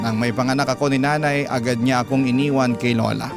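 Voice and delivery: ordinary talk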